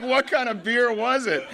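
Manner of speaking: high-pitched, laughing eager question; mock foaming excitement. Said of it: "foaming at the mouth over the story".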